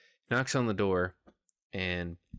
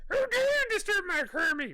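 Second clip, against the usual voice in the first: much higher and sassy, an outraged diva defending her frog; Miss Piggy; 4 dB louder than usual. Shown as a demonstration. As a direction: pitch it way up and sass every word like an offended pig diva. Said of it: "put it in a higher register. / Sass it up".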